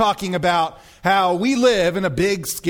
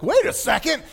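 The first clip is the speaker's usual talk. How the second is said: high-pitched mock protest; Kevin Costner's reaction; 9 semitones higher than usual. Groom objecting to the king, high startled protest. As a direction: jump up in pitch, taken aback. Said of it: "Kevin Costner's like".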